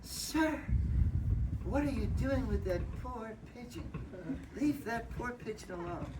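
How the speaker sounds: put-on high old lady scolding; prim old lady voice, pitched high; old lady scolding Frank over the pigeon, high and prim; little old lady. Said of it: "this little old lady comes out".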